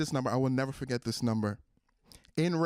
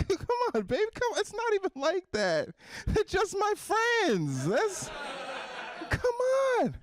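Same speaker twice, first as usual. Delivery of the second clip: high, squealing mock plea; pleading boyfriend. Caught cheater to suspicious partner, high mock pleading. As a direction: wheedle in a very high, drawn-out voice.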